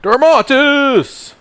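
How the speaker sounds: high, loud, very drawn-out shout; enthusiastic battle cry. Acted. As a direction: belt it out slowly with full enthusiasm. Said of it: "say it with enthusiasm".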